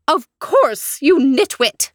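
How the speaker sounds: haughty high scolding; imperious plummy grande dame. Acted. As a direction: snap at him like a duchess. The Duchess scolds.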